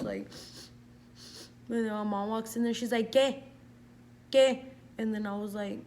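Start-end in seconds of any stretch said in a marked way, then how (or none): 3.1-4.6 loud, higher mock-mom questioning; retold sharp demand. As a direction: demand it like an alarmed mother. Mom walks in, demands answer.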